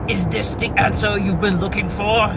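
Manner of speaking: a mild nod to a booming horror actor; Christopher Lee voice. Retold or imitated. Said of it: "in his really deep Christopher Lee voice".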